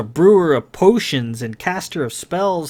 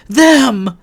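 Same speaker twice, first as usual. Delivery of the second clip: higher, louder urgent cry; Lily shouting at Stenstrom; 10 semitones higher, 8 dB louder than usual. A narrator's reading. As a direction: point and shout it.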